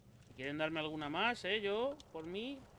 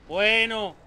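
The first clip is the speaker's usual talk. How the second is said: loud; 5 semitones higher, 17 dB louder than usual. Real, not acted. Loud outburst in game.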